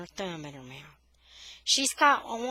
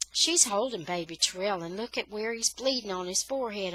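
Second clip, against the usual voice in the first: plain reading voice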